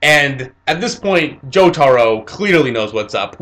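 normal talking voice

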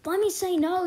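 loud, high, exasperated plea; frustrated shout. Real, not acted. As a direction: yell it at the game in frustration. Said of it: "You can't stop choosing my answers".

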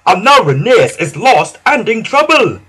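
loud, high upset reproach; indignant, worried little engine; tooted accusation. A narrator's reading. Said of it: "tooted Skarloey".

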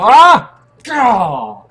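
loud high startled yell; startled scream. Real, not acted. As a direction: shriek as you get eaten. Getting eaten in game.

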